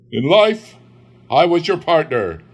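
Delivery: high, loud spectral announcement; ghostly declaration. Acted. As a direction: boom it like a phantom.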